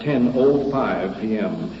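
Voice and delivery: ordinary talk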